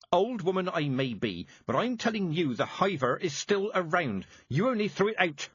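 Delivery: put-on old witch shouting in a row; shouted. A narrator's reading. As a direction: push back hard at the girl. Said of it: "Mistress Weatherwax shouted".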